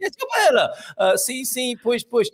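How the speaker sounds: bouncy fake Italian fillers; mock Italian. Host mimics Andy's street fillers, hammed-up Italian accent. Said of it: "Andy's conversation fillers".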